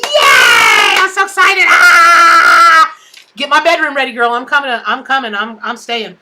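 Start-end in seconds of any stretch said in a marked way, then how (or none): 0.0-2.9 loud, high, slow joyful yell; overjoyed yell about her friend's new house; excited shout. Real, not acted. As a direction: scream it with pure joy.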